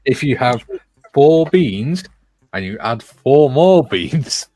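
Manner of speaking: slow patronising explanation. Pretend.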